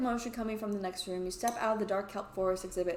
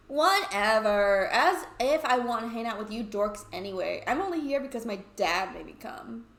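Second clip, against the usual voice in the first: scornful Toby; 7 dB louder than usual. Acted.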